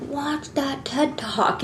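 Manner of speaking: very soft, slow, secretive; imitated whisper. Inner voice nudging someone scrolling Instagram, very hushed imitated whisper. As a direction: breathe it slowly, like a nudge in the ear. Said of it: "whispering in your ear".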